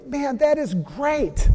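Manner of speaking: cheery Saint Peter. Retold or imitated. Saint Peter congratulating the accountant, slow and beaming. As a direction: stretch out the delight like a proud gatekeeper. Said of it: "Peter goes".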